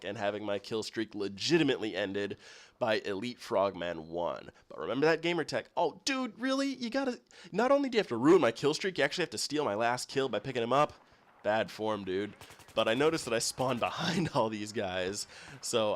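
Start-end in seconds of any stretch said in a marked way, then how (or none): 5.8-10.3 exasperated whine. Acted. Complaining about stolen kill.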